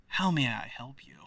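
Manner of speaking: hushed, whispery offer of help; lightly voiced self-styled leader, spoken softly; in-character greeting. Weeaboo character greets the players.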